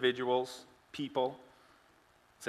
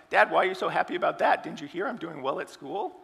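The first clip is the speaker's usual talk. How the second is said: mock indignant daughter; 6 semitones higher, 5 dB louder than usual. Daughter imagined complaining to dad.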